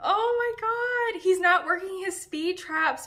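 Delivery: high, slow, incredulous oh my god; exasperated disbelief. Real, not acted. Vlogger reacting to a silly quote, high incredulous. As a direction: stretch it out in disbelief. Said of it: "exasperated outburst".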